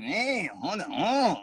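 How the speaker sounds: high, soft singsong mock Mexican tune; mimicked Mexican lilt without words; Mexican accent melody. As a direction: swoop through wordless Mexican-accent intonation. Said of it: "like, Mexicans".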